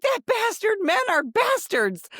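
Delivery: high-pitched mock outrage; indignant retelling. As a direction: act out indignant sympathy, high. Retold woman's outrage at cheater.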